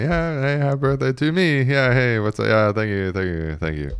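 high mock-sheepish thanks, sinking back down at the end; awkward. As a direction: imitate yourself squirming while being sung to. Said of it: "just feel awkward".

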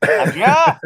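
loud call across the house; shouting a name. Real, not acted. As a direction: bellow her name. Summoning wife by shouting.

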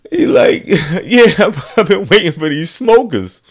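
high dad quote with laughter; laughing through quote. Real, not acted. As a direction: laugh while voicing dad. Dad again, laughing telling.

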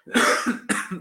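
real cough from the flu; coughing fit. Real, not acted. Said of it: "desculpa a tosse, é a gripe".

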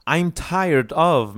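emphatic drill phrase. Shown as a demonstration. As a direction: lift it and project for the class. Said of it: "like it would be like one word".

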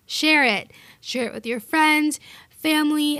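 playful bossy demand, punching share; insistent command. Shown as a demonstration. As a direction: tell them to share it, no "please". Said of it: "not please feel free to share".

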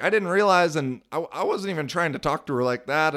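high, loud protest of innocence; defensive backpedal. Retold or imitated. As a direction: protest too much, flustered. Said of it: "totally backtracking".

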